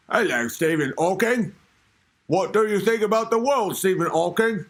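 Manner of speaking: hammed-up British questioner; exaggerated British chat-show politeness; mock British interviewer. Host playing someone questioning Hawking, mock British accent. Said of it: "sound like a British".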